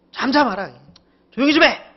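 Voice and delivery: scolding. Acted. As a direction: scold sharply in short commands. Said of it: "꾸짖으셨다는 점이 특이해요".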